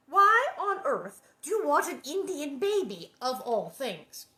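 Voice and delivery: high, loud, incredulous demand; exasperated mother. A narrator's reading. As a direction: question the child in loud disbelief. Ma questions crying Laura, exasperated.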